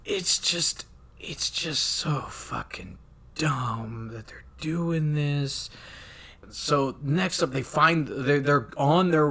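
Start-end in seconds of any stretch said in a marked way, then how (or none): none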